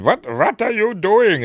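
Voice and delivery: high, loud, slow mock alarm; hammed-up alarmed captive; alarmed shout. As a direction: cry out in shocked disbelief at the captor. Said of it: "he's saying".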